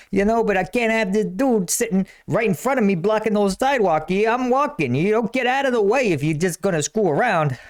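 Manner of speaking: put-on Jersey street-guy accent; fast-talking Jersey guy, pitch climbing as he gripes; Jersey type. Jersey guy griping at a dude blocking the sidewalk, brash Jersey accent. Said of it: "any of these Jersey types".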